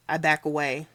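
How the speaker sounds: usual speaking voice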